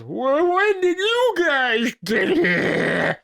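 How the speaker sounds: high, loud, slow and laughing; baffled cartoon squeal; giggly high-pitched bewilderment. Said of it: "And then he goes".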